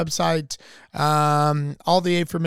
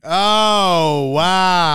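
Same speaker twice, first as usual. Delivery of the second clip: mock wow; 6 dB louder than usual. Mock amazement at song choice.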